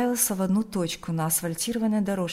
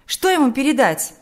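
louder, higher, annoyed question; irritated; 4 semitones higher, 6 dB louder than usual. A narrator's reading. Марьяна grudgingly offers to pass a message on, irritated. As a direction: ask with open impatience. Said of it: "раздражённо спросила Марьяна".

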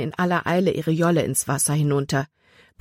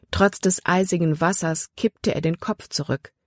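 usual speaking voice

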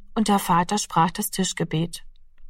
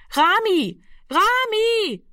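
calling into the dark; 14 semitones higher, much slower than usual. A narrator's reading. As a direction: call him over and over into the night. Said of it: "rief sie fortgesetzt in die Dunkelheit".

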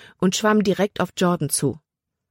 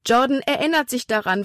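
high-pitched, eager exclamation; excited call; 7 semitones higher than usual. A narrator's reading. Libby tells Caleb of Jordan's progress, excited call. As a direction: call it out with rising hope. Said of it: "rief sie".

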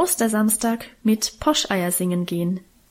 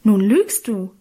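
raised, indignant exclamation; 4 dB louder, slower than usual. A narrator's reading. Sister accuses her brother of lying, indignant. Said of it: "rief ich entrüstet".

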